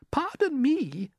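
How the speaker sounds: very high, polite and uneasy; ladybird murmur. A narrator's reading. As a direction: murmur it turning pale. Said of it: "murmured the ladybird".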